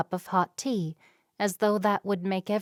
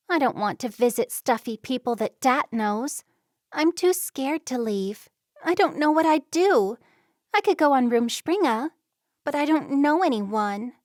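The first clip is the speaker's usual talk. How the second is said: put-on higher, louder young girl, scared and wanting out; 6 semitones higher, 4 dB louder than usual. Distressed daughter confides in her visiting friend, higher and louder. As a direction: voice the girl near tears, higher. Narrator voices Gabby.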